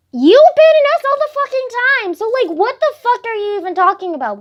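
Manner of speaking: high, loud outraged retort; angry comeback. Retold or imitated. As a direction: fire back in indignant protest. Retells angry comeback to friend.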